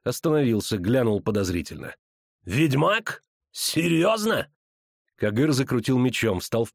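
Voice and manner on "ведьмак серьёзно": high, drawn-out questioning villain; mocking suspicion. A narrator's reading. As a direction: query it with exaggerated disbelief. (насмешливое недоверие, повыше)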